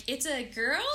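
put-on "It's a girl?" with a questioning rise on girl; surprised query, "girl" going up at the end. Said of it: "I said it like a question".

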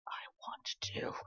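a slow soft whisper of resolve; telling her sister she wants to come, in a whisper. Retold or imitated. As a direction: breathe it quietly, firm but hushed. Quoting her quiet resolve.

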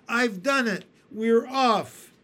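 loud, high victory shout; triumphant shout. A narrator's reading. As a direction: crow it out in triumph. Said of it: "and shouted".

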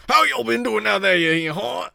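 loud, high-pitched hammed-up Louisiana accent; fake Louisiana accent. Shown as a demonstration. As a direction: put on a bad, exaggerated Louisiana twang and holler it. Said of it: "that was a really good Louisiana".

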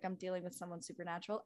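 very soft, fast, low aside; low hushed aside; hushed awe. Retold or imitated. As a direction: breathe it in awe. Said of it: "I was like".

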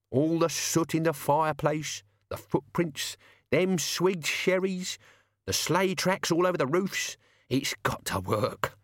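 high, chatty servant, listing; encouraging servant. Acted. Servant reassuring his master Death, encouraging.

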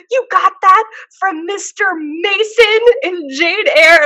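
high, loud incredulous outburst; mock scream. Friend outraged at her voice guess, mock scream. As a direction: imitate an outraged friend yelling in disbelief. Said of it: "she just screams at me".

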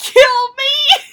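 mock despair.

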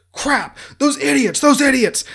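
high, strained angry rant; angry outburst. Retold or imitated. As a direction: blow up at the idiots. Washington raging behind closed doors.

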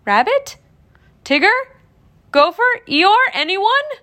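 high, rising, increasingly loud questions; nervous calling. A narrator's reading. Pooh alone in the forest, calling nervously. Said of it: "he said nervously".

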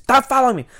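high, loud mock shout; retold angry yell. Customer being walked out by the worker, loud mock shout. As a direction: yell it like an irate man. Retelling customer yelling while walking off.

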